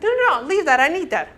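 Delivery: a touch of a panicked hoarder; protesting. Retold or imitated. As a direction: clutch at the clutter, pleading. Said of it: "the sanguine's like".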